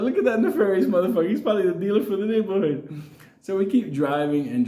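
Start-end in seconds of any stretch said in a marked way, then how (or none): 0.0-3.0 higher, fast, laughing commentary; gleeful mock-suspicious aside; amused remark. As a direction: narrator cracking up at the shady animal, pitched up. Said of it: "I was like".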